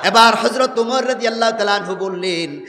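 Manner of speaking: usual speaking voice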